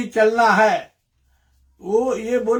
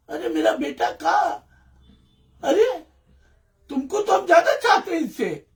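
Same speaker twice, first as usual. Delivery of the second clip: father soothing daughter; 7 semitones higher than usual. Retold or imitated.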